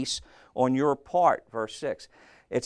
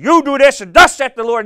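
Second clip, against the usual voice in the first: loud, high, bossy mock-prophet shout; mock commanding shout; 9 semitones higher, 13 dB louder than usual. Preacher mimicking those who order the congregation around, commanding shout. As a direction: bellow it like an overbearing preacher giving orders. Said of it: "I don't walk around and say".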